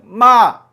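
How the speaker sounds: 마 dropping sharply from high, loud; fourth tone. Shown as a demonstration. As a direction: snap 마 down from the top like a shout. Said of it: "소리치듯이".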